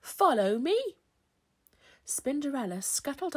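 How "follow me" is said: high, commanding call; raised, theatrical godmother voice; loud hairy godmother. A narrator's reading. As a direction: summon them along grandly. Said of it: "came a loud voice".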